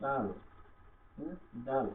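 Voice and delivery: ডাল with a very soft d; soft dal. Shown as a demonstration. Teacher models ডাল with its d kept very soft. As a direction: say ডাল softly, gentle d. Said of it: "একবারে নরম করে".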